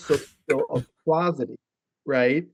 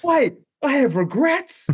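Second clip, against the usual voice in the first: high, drawn-out comic outcry; mock anguished regret; 13 semitones higher, 3 dB louder, slower than usual. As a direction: cry it out way up high in pretend dismay. Acting out belated regretful realization.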